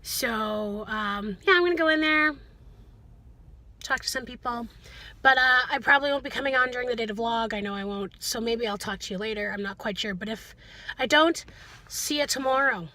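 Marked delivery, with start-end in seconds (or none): none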